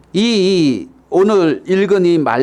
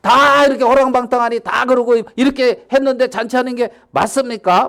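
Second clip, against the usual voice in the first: fast-building high-pitched grievance; worked-up, raised-pitch protest; indignant son; 9 semitones higher than usual. Acted. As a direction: complain bitterly about the unfairness. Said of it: "막 그러면서 이제 지금".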